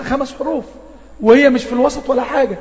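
ordinary delivery

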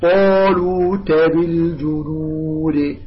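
very slow recitation, dark rounded طالوت; measured tajweed model of طالوت; correct heavy Ta. Shown as a demonstration. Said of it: "طالوت خطأ".

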